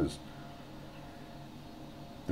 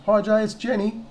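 high, loud, chirpy caller impression; raised-pitch mock policewoman; policewoman on phone; 7 dB louder than usual. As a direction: imitate a woman announcing herself on the phone. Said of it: "she goes".

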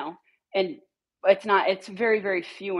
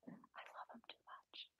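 whisper; 34 dB quieter than usual. Real, not acted. Whispered confession of love.

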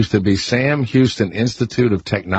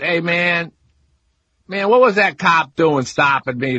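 higher New Orleans drawl, imitating a morning DJ; mock a loose New Orleans radio guy; New Orleans DJ accent; 8 semitones higher than usual. Host imitating New Orleans morning DJs, mock accent. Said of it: "they talk like that".

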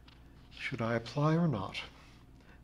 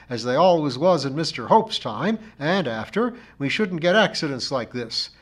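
raised, louder reproving voice; severe; 4 semitones higher, 8 dB louder than usual. A narrator's reading. Missus Pringle blames the narrator for the maps, severe. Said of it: "continued Missus Pringle severely".

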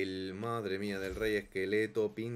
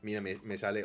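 ordinary delivery

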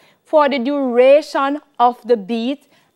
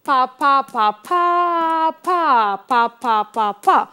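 pa syllables repeated; rhythm syllables. Shown as a demonstration. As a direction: speak the rhythm again in pa syllables. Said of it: "One more time".

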